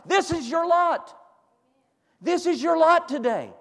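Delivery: scripture read out, climbing into loud, high exhortation; full-force preaching. Real, not acted. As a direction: build to a fervent, ringing pulpit climax.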